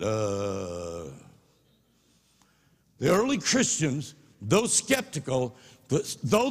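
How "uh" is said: low, flat startled uh; a speechless grunt; stunned grunt. Retold or imitated. The skeptic left speechless, grunting.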